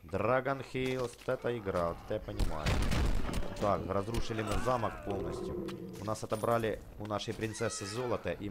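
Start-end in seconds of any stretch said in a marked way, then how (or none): none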